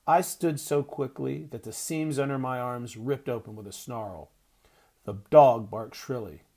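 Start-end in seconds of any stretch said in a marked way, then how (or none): none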